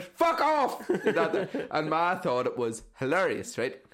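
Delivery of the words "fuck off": high, loud frightened yell; startled shout. Retold or imitated. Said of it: "and I shouted".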